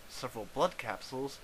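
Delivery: ordinary delivery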